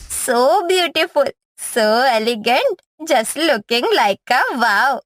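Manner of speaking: slow, drawn-out admiring English; gushing English. Acted. Mother-in-law gushing over the foreign bride. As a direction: savor each word of praise slowly.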